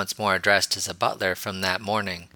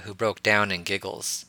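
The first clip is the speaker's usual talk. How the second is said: plain reading voice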